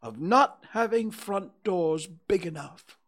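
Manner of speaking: crossly, pitched high; indignant complaint. A narrator's reading.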